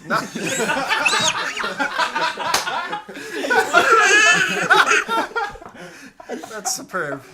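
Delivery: big loud laugh then speaking. Real, not acted. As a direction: laugh hard, then get words out.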